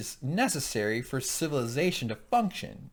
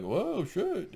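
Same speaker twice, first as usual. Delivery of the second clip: high-pitched play-acted alarm; hammed-up surprise, pitched up; mock startled; 8 semitones higher than usual. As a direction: react like a startled henchman. Acts out startled goons.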